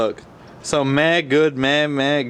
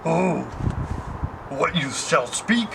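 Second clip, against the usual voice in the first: put-on slow, higher, changed wise-old-alien voice; Yoda impression; 5 semitones higher, much slower than usual. Shown as a demonstration. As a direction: drag it out like the little Jedi master. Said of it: "But then Yoda's like".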